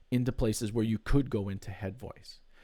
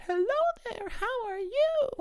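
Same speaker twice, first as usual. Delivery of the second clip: high falsetto character voice. Shown as a demonstration. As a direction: greet them in a squeaky falsetto character. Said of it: "sounds like I got two different characters".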